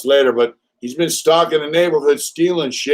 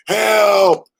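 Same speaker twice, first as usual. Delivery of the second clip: higher mock cry of help, echoing him; 7 semitones higher, 4 dB louder than usual. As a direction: parrot his yell back at him. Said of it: "I would say".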